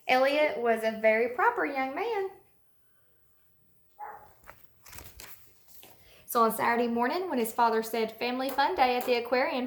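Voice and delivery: put-on storybook read-aloud for little kids; reading to children. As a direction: read it like story time for a class. Teacher reads picture book aloud to kids.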